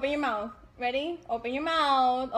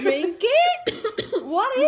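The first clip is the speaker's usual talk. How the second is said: louder, laughing disbelief; surprised; 6 semitones higher, 5 dB louder, much slower than usual. Real, not acted. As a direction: repeat it in laughing shock.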